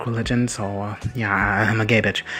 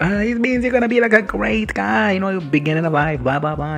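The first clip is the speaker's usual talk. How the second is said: mocking Jorah's voice; 8 semitones higher than usual. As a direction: lightly parody a fortune teller spinning a nice tale. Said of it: "He's like".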